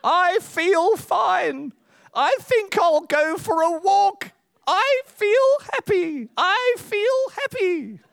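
put-on very high, slow, sing-song old-man voice; high, slow comic old-man voice insisting he feels happy. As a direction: chirp it like a frail old man pretending he's fine. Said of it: "this old man says".

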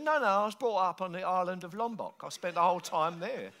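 put-on English accent, matter-of-fact denial; strong English accent. Local denying he ever lived in Britain, strong English accent. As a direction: deny it in an imitated English accent. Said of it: "you've got a very strong English accent".

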